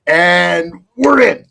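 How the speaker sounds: a bandleader's big slow count-in; calling the band in. Retold or imitated. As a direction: cue the band like a bandleader, stretching each word. Cueing the band back in.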